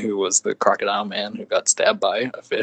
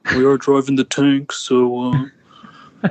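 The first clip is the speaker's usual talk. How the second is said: mock Triple H insisting on his status; Triple H impression. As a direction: play the pompous champ defending his rank. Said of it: "That's a nailed-on Triple H impression".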